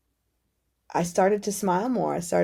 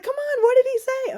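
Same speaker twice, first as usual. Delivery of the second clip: much higher, louder, insistent; eager pushy friend; 5 dB louder than usual. Retold or imitated. Friend badgering her about God's message, high and eager. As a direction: pester her like an impatient friend.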